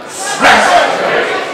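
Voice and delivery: loud, emphatic shout of one word; like you mean it. Shown as a demonstration. Preacher leads congregation in repeating the word, forceful shout. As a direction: belt it out like you've been there. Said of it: "Say it like you mean it. Say it like you've been there".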